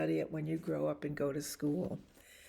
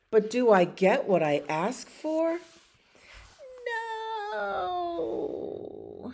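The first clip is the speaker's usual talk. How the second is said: indignant pigeon; 13 semitones higher, 4 dB louder, slower than usual. Acted. Pigeon complaining in story.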